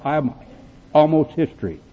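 ordinary talk

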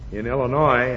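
ordinary talk

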